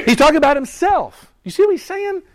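rising excitement. Real, not acted. Preacher builds excitement.

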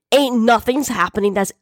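rising, high-pitched angry snarl; furious snarl. A narrator's reading. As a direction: build from anger into a high outburst. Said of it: "Big Mac snarled furiously".

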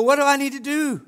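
loud, high acted plea; urgent plea. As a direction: cry out like someone seeking help. Acts out desperate seeker asking.

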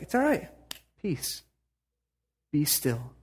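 calm Jesus. Retold or imitated. Jesus calming the storm.

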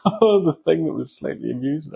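high, giggly parody voice through a laugh; laughing put-on echo; mock laughing repeat. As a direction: mimic a sidekick cracking up. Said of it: "laughing uncontrollably and then repeating".

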